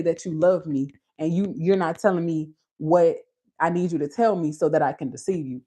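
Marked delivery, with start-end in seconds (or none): none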